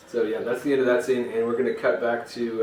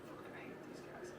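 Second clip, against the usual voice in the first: very quiet, under-the-breath grumble; grumbling; 23 dB quieter than usual. Acted. Crowd sick of the Dungeon Dogs, grumbling. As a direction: grumble it low to yourself. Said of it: "Everybody just grumbles and pisses".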